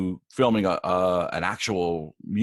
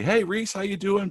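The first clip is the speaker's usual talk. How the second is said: friendly star greeting; 10 semitones higher than usual. Retold or imitated. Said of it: "talks to me directly".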